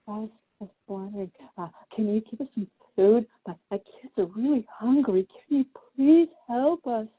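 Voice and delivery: lightly voiced pleading poor father. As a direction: beg gently for your hungry kids. Poor father asks for food.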